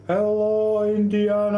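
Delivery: high, drawn-out sing-out hello; hailing greeting. Real, not acted. Streamer greets a returning viewer, high drawn-out hail. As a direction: call out a long, high greeting.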